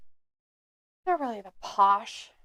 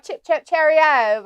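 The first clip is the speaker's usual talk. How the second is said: lightly put-on London accent; mock London accent; 4 semitones higher, 4 dB louder than usual. Co-host echoes friend's joke about Londonish accents, mock London accent. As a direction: echo the phrase in mock British. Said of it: "Londonish type accents".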